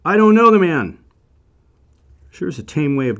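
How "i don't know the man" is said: high, loud oath of denial; swearing denial. Acted. Peter swears to the crowd he doesn't know Jesus, loud. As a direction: swear it out, desperate to convince. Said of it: "he swore to them".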